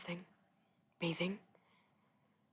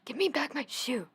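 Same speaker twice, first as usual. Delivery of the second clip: higher, louder indignant demand; angry; 6 semitones higher, 6 dB louder than usual. A narrator's reading. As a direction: demand it crossly, pitched up. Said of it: "grew angry".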